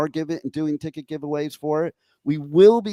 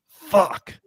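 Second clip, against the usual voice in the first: whispered curse. Retold or imitated. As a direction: breathe the word out. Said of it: "and go".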